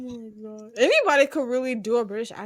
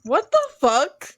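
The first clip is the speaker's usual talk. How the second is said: loud, higher, appalled reaction; shocked disbelief; 4 semitones higher, 9 dB louder than usual. Real, not acted. Hearing friend's Ramsay crush, shocked. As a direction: blurt it out, really appalled.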